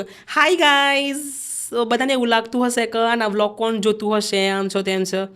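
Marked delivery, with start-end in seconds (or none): 0.0-1.2 a higher, put-on vlogger greeting; changed tone. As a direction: pitch "Hi guys" up in a new voice. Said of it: "મેં થોડું tone બદલીને કીધું કે". (ટોન બદલીને ઊંચા અવાજે "Hi guys")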